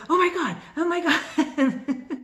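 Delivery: high, slow, laughing oh my God; retold giddy shock on the phone; giddy disbelief. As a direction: gush it out through laughter. Calling husband with the news.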